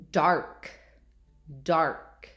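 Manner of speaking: DARK said alone, firm and falling; careful word model. Shown as a demonstration. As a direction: enunciate DARK for young listeners. Teacher models test word for listening.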